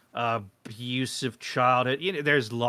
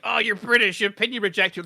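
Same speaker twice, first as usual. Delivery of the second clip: high, loud imitation of naysayers; mock dismissive commenters; 9 semitones higher, 5 dB louder than usual. Commenters rejecting a British opinion, mockingly imitated high and loud. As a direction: mimic them in a raised voice.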